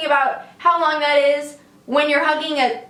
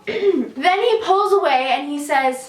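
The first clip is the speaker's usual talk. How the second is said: ordinary talk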